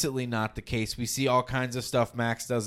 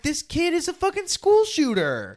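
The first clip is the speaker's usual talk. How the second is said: outraged; 13 semitones higher, 4 dB louder than usual. Real, not acted. Outraged at the character.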